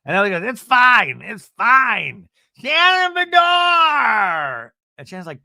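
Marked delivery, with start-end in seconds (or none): 0.4-4.7 high, loud, slowly drawn-out name call; hammed-up impatient shouting; mock impatient yell. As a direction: bellow the name like you've lost patience. Emily impatiently yelling name.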